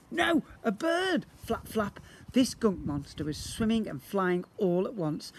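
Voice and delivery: usual speaking voice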